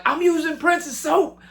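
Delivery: giddy squeal. Retold or imitated. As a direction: marvel like a starstruck kid. Starstruck fans in [name]'s restroom.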